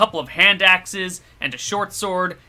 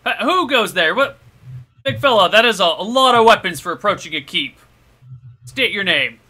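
gate guard; 5 semitones higher, 6 dB louder than usual. Acted. Gate guard challenging a stranger.

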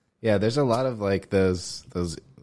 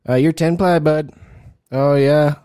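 loud, higher-pitched Canadian impression; Canadian accent; 6 semitones higher, 9 dB louder than usual. Shown as a demonstration. Improv student asked for Canadian Spanish, manages a loud Canadian accent. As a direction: punch out a hammed-up Canadian accent. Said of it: "That's, that's Canadian".

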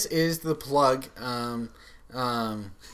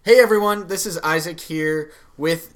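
normal talking voice

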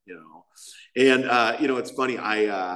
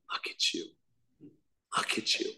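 retold, whispered and tearful, much softer; 5 semitones lower, 10 dB quieter than usual. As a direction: breathe it out like a dean near tears. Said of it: "And she started crying".

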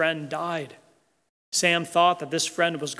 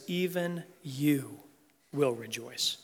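slow and softer, "you" held before a pause; stress on YOU; 6 dB quieter, slower than usual. Real, not acted. Preacher assuring the sad and cynical, soft lingering stress on "you". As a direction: hush slightly and linger on "you", then let the rest drop. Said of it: "emphasis on the word "you".

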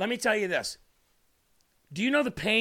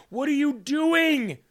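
loud, high, drawn-out scolding; exasperated plea; 6 semitones higher, 5 dB louder, slower than usual. Acted. Preacher voicing Jesus to a suffering believer, exasperated. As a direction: cry out to stop them hurting themselves. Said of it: "He's probably going".

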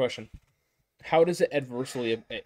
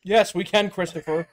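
loud, higher-pitched comeback; emphatic retort; 6 semitones higher, 8 dB louder than usual. Real, not acted. Co-host answers his friend's Christmas card jab, emphatically. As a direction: answer him loudly and emphatically.

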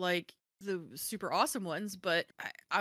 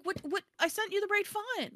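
high-pitched, incredulous retort; baffled protest; 12 semitones higher than usual. Retold or imitated. Author retelling her reply to the cover designer, baffled. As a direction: protest it in mock bewilderment.

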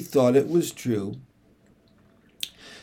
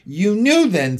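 surprised; 6 semitones higher, 5 dB louder than usual. Acted. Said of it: "rather surprised".

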